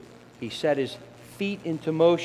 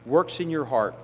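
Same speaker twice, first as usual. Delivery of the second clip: ordinary talk, nothing marked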